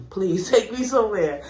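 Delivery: giggling through the plea; laughing. Real, not acted. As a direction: laugh while begging.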